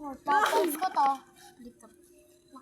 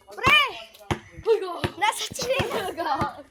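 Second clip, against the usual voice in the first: loud sudden call; shouting; 5 semitones higher, 4 dB louder than usual. Real, not acted. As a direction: call out across the street. Calling out to friend.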